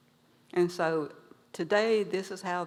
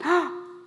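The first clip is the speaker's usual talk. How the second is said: gasp; 9 semitones higher, 3 dB louder than usual. Acted. Big brother sees the stripes.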